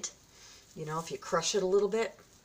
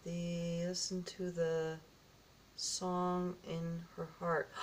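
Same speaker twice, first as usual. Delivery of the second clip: slow, soft, word-by-word reading; halting reading; 9 dB quieter, slower than usual. Real, not acted. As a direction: puzzle out the writing quietly, stretching each word. Works out small writing.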